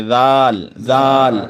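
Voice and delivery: زال light and thin. Shown as a demonstration. Teacher showing pupils a thin زال. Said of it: "نہایت پتلی آواز سے پڑھیں گے".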